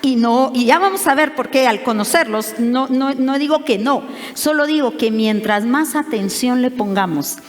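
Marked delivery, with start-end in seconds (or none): none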